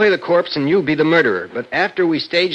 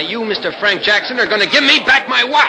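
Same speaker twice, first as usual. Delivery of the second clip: heated, rising threat; menacing demand; 6 semitones higher than usual. Acted. Brother demanding the watch, increasingly angry. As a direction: build pressure toward a fight.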